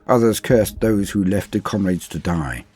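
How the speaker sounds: ordinary delivery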